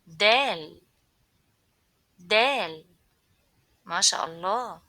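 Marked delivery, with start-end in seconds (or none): 0.1-2.8 loud clear Dal; clear teaching model. Shown as a demonstration. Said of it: "Repeat after me".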